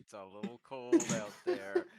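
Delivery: loud, drawn-out mock whine; mock complaining. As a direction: exaggerate the complaint, slow and loud. Mock complaint repeated louder.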